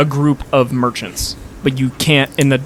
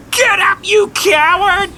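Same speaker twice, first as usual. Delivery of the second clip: high-pitched heckling shout; Jacqueline. Acted. Merchant jeering the fallen fighter, shrieking. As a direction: screech it from the crowd in a put-on voice. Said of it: "is Jacqueline".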